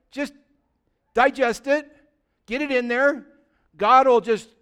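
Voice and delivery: loud, driving pulpit preaching; full-force sermon delivery; fiery preaching. Acted. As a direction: preach it hard to the congregation.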